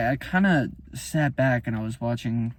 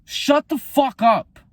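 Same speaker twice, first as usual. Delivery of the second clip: higher, louder furious yell; angry shout; 10 semitones higher, 5 dB louder, slower than usual. Real, not acted. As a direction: cut them off hard. Angry outburst repeated.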